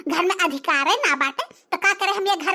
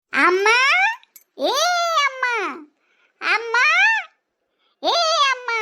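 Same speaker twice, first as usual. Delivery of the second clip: repeated shouting; 7 semitones higher than usual. Acted. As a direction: yell until your throat hurts. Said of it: "चिल्ला चिल्ला हमार गटाई फाट जात बा".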